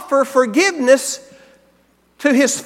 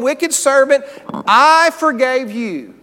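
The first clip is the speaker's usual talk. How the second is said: slow, heavy stress on I in "I forgave you"; emphatic reading; 2 semitones higher, 4 dB louder, slower than usual. Shown as a demonstration. Preacher rereading the master to his servant, slow and emphatic. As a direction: lean on every word to make them notice. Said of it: "notice the parties that he emphasizes".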